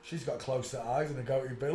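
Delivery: ordinary talk, nothing marked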